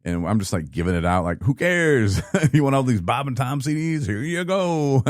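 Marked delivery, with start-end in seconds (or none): 1.4-5.0 high, laughing, who-cares handout; careless giveaway. Retold or imitated. Quitting worker handing out swag, laughing and carefree. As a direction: toss it all away with a laugh.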